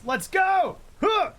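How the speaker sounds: high hyped call; excited. Real, not acted. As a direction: pump it up. Hyped during game action.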